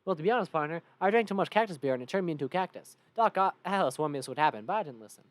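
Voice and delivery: loud Southern drinker's tale; Cactus Bill. Acted. Cactus man explains his fate, loud Southern voice. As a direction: tell it like a Southern cowpoke. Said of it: "Cactus Bill".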